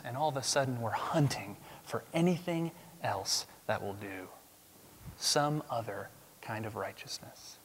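hushed confiding whisper. Real, not acted. Preacher whispering to the congregation about false righteousness. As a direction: lean in and whisper it.

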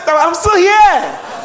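loud, high triumphant shout. Shown as a demonstration. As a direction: shout it out with joy.

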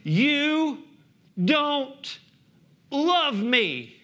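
high-pitched, slow mock child complaint; hammed-up angry child accusing a parent; mad kid. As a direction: play a sulky kid, each word set apart. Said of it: "when they get mad at their parents".

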